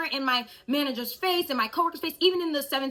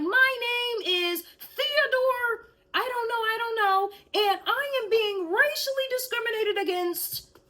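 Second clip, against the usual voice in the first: high mock whiny voice; mocking the man; 10 semitones higher than usual. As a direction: parody his self-pitying claim. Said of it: "And he is like".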